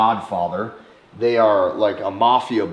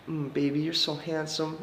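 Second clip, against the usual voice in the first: lightly voiced sweet feminine coo, much softer; soft flirty woman; 4 semitones higher, 9 dB quieter than usual. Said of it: "her voice is very soft, very sweet, very feminine, very flirty".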